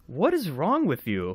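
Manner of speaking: high, slow, incredulous question; mock disbelief. Real, not acted. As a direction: draw it out, baffled by a colleague. Mock disbelief at odd keyboard.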